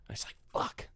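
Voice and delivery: soft unvoiced curse; whispered letdown. Real, not acted. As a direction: breathe it out in defeat. Disappointed aside.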